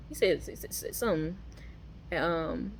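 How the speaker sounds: ordinary delivery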